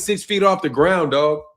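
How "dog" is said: normal talking voice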